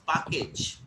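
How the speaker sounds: "package" with open "ah" in first syllable; Indian pronunciation. Shown as a demonstration. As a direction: say "package" as "pah-kage". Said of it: "most of the Indians".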